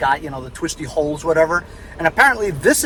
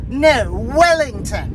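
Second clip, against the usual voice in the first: slow, higher imitation Australian accent; down under accent; 6 semitones higher, slower than usual. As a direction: correct someone in a put-on Australian accent. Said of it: "in a down under accent".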